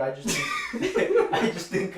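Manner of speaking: laughing through the words; words broken by laughter. Real, not acted. Laughing at friend's reaction.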